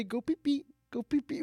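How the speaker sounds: put-on high soft sing-song baby talk; baby talk to dog. Shown as a demonstration. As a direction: coo gently at a puppy. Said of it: "No, you say".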